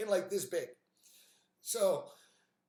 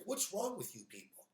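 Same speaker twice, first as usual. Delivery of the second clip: exasperated aside; 9 dB quieter than usual. Retold or imitated. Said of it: "I was like".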